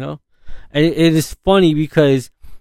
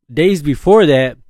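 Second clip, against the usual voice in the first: ordinary talk, nothing marked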